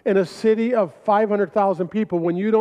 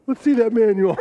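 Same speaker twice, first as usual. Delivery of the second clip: laughing concession; 3 semitones higher than usual. Retold or imitated. Guy gives in, laughing.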